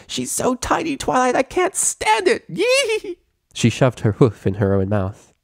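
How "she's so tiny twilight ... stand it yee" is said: very high, gushing squeal; can't contain her delight over the tiny baby; squealing excitement. A narrator's reading. Pinkie gushing over newborn.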